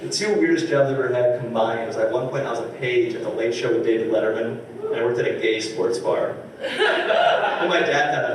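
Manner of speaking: ordinary talk, nothing marked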